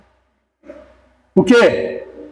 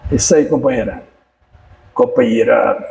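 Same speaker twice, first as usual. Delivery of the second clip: deep mock praise; 10 semitones lower than usual.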